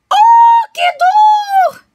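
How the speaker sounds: very high shriek of pain; shrill yelp from a sudden blow, loud; pained cry. A narrator's reading. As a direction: cry out as the log hits your jaw. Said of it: "exclamou o jacaré".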